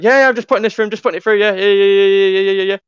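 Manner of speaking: high, loud, hurried yeah-yeahs; mocking Dan. Imitating Dan brushing off a talkative customer, high and loud. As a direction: gabble yeah over and over, brushing him off. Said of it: "and then Dan".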